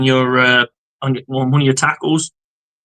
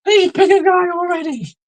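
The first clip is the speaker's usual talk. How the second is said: high, exasperated whine; fed-up defenders begging in a high voice. Retold or imitated. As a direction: plead in mock frustration. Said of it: "So everyone's like".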